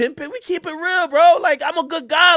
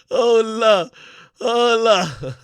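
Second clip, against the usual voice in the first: ordinary talk, nothing marked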